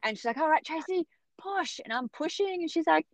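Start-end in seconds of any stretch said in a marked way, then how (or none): 0.4-1.8 much higher, gentle coaxing push; coaching doctor. Retold or imitated. Obstetrician urging Tracy to push, high and coaxing.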